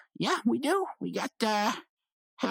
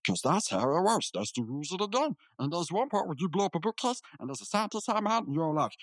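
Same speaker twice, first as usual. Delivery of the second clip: ordinary talk, nothing marked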